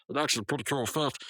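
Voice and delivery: ordinary talk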